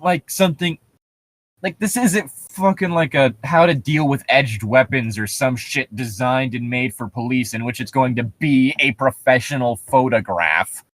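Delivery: ordinary delivery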